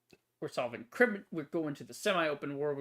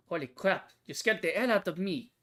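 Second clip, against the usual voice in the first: raised, startled exclamation; 5 semitones higher, 4 dB louder than usual. Acted. Character scared by someone sneaking up, startled. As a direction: react as if just given a scare.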